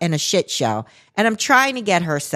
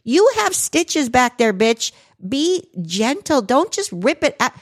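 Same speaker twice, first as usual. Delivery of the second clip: scolding mom; 5 semitones higher than usual. Retold or imitated. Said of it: "And I went like".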